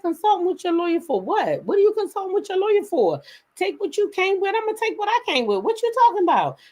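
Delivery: high-pitched incredulous challenge; retold indignant questioning, pitched high; incredulous comeback. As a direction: fire back in disbelief, voice way up. Acted quarrel with her husband.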